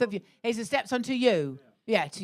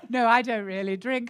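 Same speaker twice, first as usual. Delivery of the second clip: usual speaking voice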